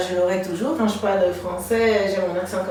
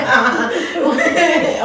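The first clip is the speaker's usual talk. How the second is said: higher-pitched peal of laughter; real laugh; 5 semitones higher than usual. Real, not acted. Host praises how far guest has come, laughing. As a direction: let out a high laugh.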